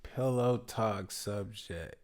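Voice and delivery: slow, softly, like late at night; sleepy voice. Pretend. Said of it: "we doing our sleepy voice".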